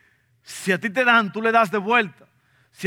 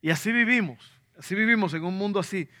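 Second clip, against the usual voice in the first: normal talking voice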